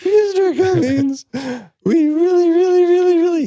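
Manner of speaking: put-on a small boy choking back tears; little boy voice. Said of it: "trying not to cry".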